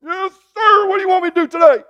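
high, loud barked yes-sir to a commanding officer; a dutiful soldier reporting for orders, loud and high; mock soldier's reply. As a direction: shout it like a recruit taking orders. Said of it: "showing up each morning and going".

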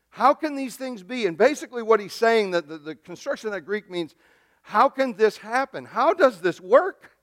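full-force sermon delivery; preaching. Acted. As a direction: preach the scripture out loud and high.

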